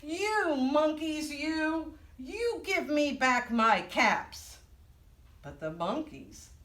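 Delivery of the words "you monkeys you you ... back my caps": higher, drawn-out mock scolding; storybook peddler telling them off. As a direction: scold the monkeys slowly, word by word. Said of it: "this made the peddler angry".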